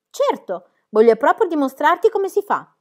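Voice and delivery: high, loud and cocksure; proud. A narrator's reading. Book proudly answering Jim's challenge, boastful. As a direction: boast that you'll show him. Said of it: "rispose fieramente Book".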